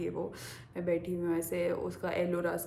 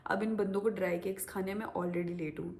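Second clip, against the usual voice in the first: usual speaking voice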